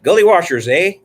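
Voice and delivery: punchy attempt at a Minnesota accent; bad Minnesota accent. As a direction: badly fake a Minnesota accent. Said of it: "I can't do a Minnesota accent".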